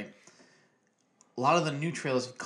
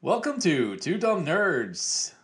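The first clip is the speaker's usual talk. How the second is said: slow, booming radio announcer; 4 semitones higher, 4 dB louder, much slower than usual. Shown as a demonstration. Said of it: "Was that my radio voice?".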